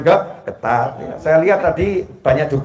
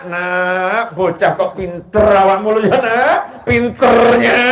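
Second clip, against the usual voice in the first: exasperated parent, voice climbing higher and louder; mock scolding parent; 3 semitones higher, 3 dB louder, slower than usual. Simbah angry at a child but calling them clever, mock scolding. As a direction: scold the child through words of praise. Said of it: "Marahnya sama anak".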